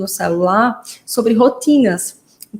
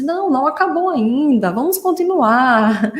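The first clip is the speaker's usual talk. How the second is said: higher, playful sing-song reply; therapist coaxing a child; 4 semitones higher, slower than usual. Retold or imitated. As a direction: re-enact coaxing a child on, stretching the last words. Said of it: "Eu disse". (terapeuta animando a criança, em tom cantado)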